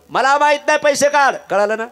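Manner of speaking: loud, high, harsh demand; angry moneylender. Acted. Lender shouts demand. (सावकाराची रागीट मोठी मागणी)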